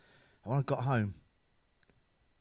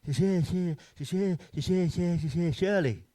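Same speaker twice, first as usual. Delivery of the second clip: stutter. Retold or imitated. Stuttering sex line woman.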